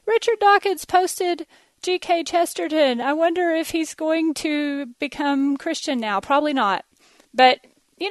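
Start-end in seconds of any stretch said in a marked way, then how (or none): 0.0-6.2 much higher, breathless excited gush; high excited gasp. Pretend. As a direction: gush like a thrilled fan. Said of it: "We're, we're sort of like".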